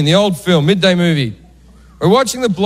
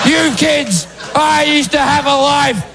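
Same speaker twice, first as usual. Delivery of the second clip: mock furious mother, pitched up; angry mum; 5 semitones higher, slower than usual. Mum threatens her cheeky son from her chair, angry. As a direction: scold and threaten like a fed-up mum. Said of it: "she's so fat and so angry".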